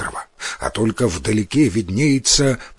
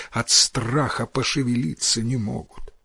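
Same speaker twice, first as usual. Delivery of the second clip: usual speaking voice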